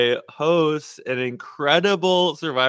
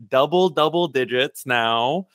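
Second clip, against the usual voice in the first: normal talking voice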